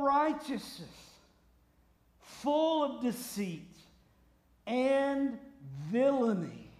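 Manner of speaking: loud, high, drawn-out condemnation; furious rebuke. Acted. Paul denouncing an enemy of the gospel, furious rebuke. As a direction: thunder each phrase, with long pauses between.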